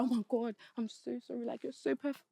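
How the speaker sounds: soft mock-desperate pleading; hushed, gushing imitation of a besotted man; imitating clingy apology. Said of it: "throwing a whole tantrum".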